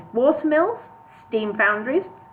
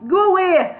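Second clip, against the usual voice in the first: much higher, louder Brummie call; Birmingham accent, retried; 10 semitones higher, 6 dB louder than usual. A narrator's reading. Bingo calls through the door again, now broader Brummie. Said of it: "I can't do a Birmingham accent".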